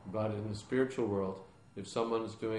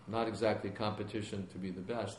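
usual speaking voice

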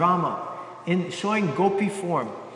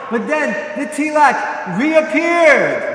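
higher, louder and drawn out with wonder; excited exclaim; 6 semitones higher, 7 dB louder, slower than usual. A narrator's reading. Storyteller revealing the miracle to listeners, awed and loud. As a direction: land the miracle slowly, with a burst of awe.

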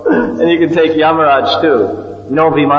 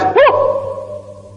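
very high breathless calf yelp; startled calf cry. Retold or imitated. Speaker voicing the calf as Krishna grabs its tail, high startled yelp. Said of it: "The calf goes".

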